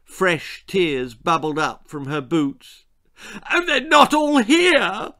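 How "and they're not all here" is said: very high, loud wail; piercing, distraught cry. A narrator's reading. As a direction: wail it in tearful fury. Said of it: "she wailed".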